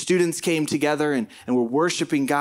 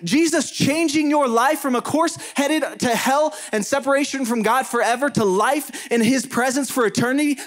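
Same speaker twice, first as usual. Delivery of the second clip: raised, high, fervent preacher voice with punched words; preaching at full force; 8 semitones higher than usual. Acted. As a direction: build to a rousing sermon peak. Preaching climax on life change.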